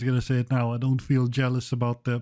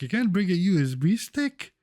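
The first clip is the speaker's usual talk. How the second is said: incredulous; 7 semitones higher than usual. Real, not acted. Reacting in disbelief to banned items.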